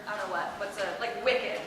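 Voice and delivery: ordinary talk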